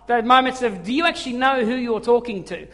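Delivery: ordinary talk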